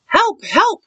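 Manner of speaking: high-pitched storybook yelp for help; crying for help. A narrator's reading. Rabbit calling his friends for help, high cry. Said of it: "cried Rabbit".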